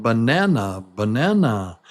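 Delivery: "Banana" with a lengthened NAN; buh-NAAN-uh for "banana"; longer stressed vowel. Shown as a demonstration. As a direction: hold the middle of "banana", hurry the ends. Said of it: "It takes more time".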